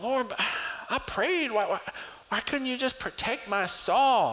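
high, pleading, aggrieved whine; whiny complaint to God. Retold or imitated. Pastor whining to God about his wet saw, high whine. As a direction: complain like a hurt child. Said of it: "I was like".

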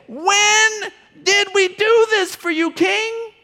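wide-eyed disbelief at the king; puzzled question. Retold or imitated. The people asking the king in the parable, high and astonished.

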